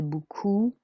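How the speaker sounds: "beaucoup" ending in a wide-mouthed oo. Shown as a demonstration. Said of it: "it's a really wide-mouthed oo sound".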